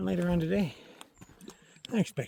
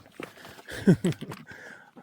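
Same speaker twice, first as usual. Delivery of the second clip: laugh after a fall; 6 semitones higher, 4 dB louder than usual. Real, not acted. Laughing after falling on rock.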